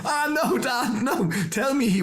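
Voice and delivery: high, laughing protest; pleading son. Retold or imitated. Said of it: "I'm going".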